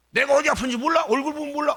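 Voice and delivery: loud, high mock outburst; exasperated retort. Family member who won't say what hurts, exasperated. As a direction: fire it back like an aggrieved family member. Acted quarrel over unspoken pain.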